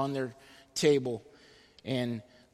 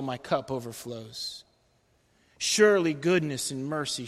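solemn psalm reading, rising on the valley line; scripture reading. Acted. Pastor closing the sermon with Psalm twenty-three, solemn scripture reading. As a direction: read the psalm over the congregation. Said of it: "I want to close in reading this over us".

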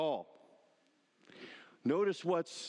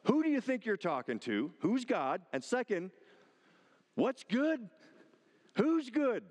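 higher, pushy rhetorical questions; confrontational challenge; 8 semitones higher than usual. Retold or imitated. Jesus verbally shoving the rich young ruler, confrontational. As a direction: shove him off balance with each question.

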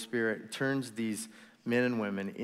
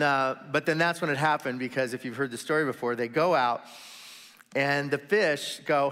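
usual speaking voice

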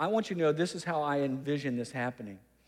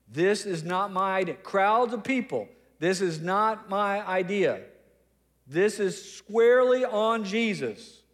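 higher, emphatic public disclaimer; very clear; 3 semitones higher, 3 dB louder than usual. Acted. Peter telling crowds of people who is responsible, emphatically clear. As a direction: announce it to everyone, stressing each word. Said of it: "he wants to make it very, very clear".

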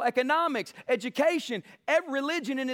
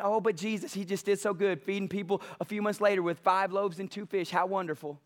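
lower, softer, syrupy admiration; mock sentimental gush; 5 semitones lower, 3 dB quieter than usual. Preacher mimicking naive readers, lower and syrupy. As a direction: gush sweetly like a naive believer.

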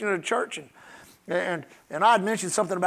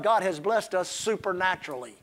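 normal talking voice

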